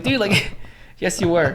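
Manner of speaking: high, loud "dude" breaking into a laugh; laughing retort. Retold or imitated. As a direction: laugh at his obvious lie. Retorting to the sleepy ranger.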